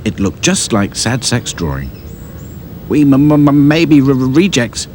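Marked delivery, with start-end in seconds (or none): 2.9-4.9 stuttering on each start; stammering. A narrator's reading. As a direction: stammer it out nervously. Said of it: "stammered Hi-Fi".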